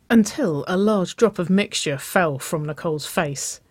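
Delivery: ordinary delivery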